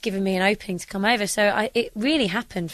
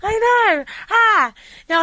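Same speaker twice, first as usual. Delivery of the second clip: giggly squeal, much higher and louder; embarrassed laugh; 13 semitones higher, 8 dB louder, slower than usual. Real, not acted. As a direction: laugh off the teasing, flustered. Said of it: "You're blushing right down to".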